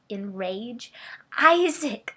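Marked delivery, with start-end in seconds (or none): 1.4-2.1 loud high sobbing call; sobbed. Acted. Mother sobbing out her son's name. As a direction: cry the name out through tears. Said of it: "I sobbed".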